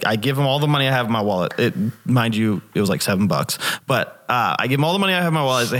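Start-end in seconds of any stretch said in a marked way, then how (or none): 4.6-5.7 rushed excited retelling. Retold or imitated. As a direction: rattle it off in a hurry, eager.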